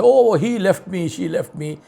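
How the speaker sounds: mock crocodile tears. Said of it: "cry crocodile tears and say".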